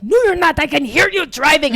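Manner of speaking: loud, high mock accusation; French boy. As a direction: protest loudly as an imitation of him. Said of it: "French boy".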